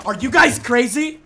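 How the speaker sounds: loud disbelieving yell at chat; incredulous shout. Real, not acted. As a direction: shout it like they've lost their minds. Reacting to chat's dare.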